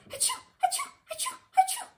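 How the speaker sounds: big escalating sneezes; loud comic sneezing fit; series of sneezes. A narrator's reading. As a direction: sneeze harder each time.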